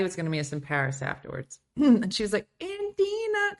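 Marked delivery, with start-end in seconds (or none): 2.6-3.6 high nasal mock Natalia; a lightly voiced Natalia calling her name; imitating Natalia. Friend on the phone, mimicking how Natalia said her name. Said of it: "'cause that's how she sounded".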